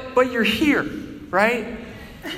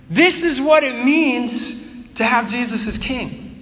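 ordinary delivery

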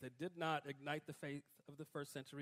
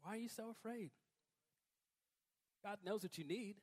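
gentle reply; 6 dB quieter than usual. Pretend. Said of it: "and God says".

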